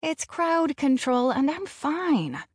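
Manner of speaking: mild grumble at an overprotective dad; grumbling. A narrator's reading. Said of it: "I grumble".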